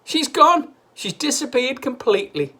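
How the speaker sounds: high, loud cheer; gleeful shout. A narrator's reading. Mister Cranky cheering Grandma's vanishing, high and loud. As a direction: cheer with glee.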